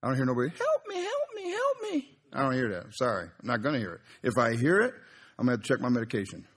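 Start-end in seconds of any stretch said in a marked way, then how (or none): 0.5-2.2 tiny, very high squeaky plea; slow falsetto pleading, hammed up; mock cry for help. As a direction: squeal softly like a little helpless victim. Voices trees crying for help.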